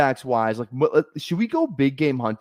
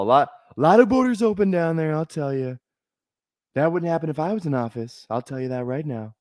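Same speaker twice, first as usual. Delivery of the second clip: camped-up presidential parody; comic politician voice, lightly lifted in pitch; Trump impersonation; 3 semitones higher than usual. Acted.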